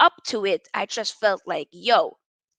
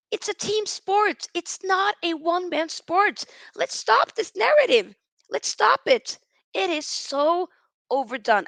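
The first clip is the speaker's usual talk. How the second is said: heated rant; 6 semitones higher than usual. Real, not acted. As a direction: hammer the point, voice up high. Ranting against the narrative.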